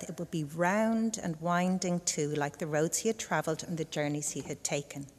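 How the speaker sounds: usual speaking voice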